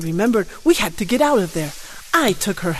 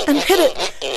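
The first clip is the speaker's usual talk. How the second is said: usual speaking voice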